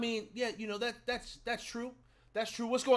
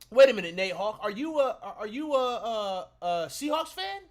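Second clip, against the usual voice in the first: high, loud disbelief; shocked at a Seahawks fan; incredulous; 4 semitones higher, 7 dB louder than usual. Real, not acted. Surprised at viewer's fandom.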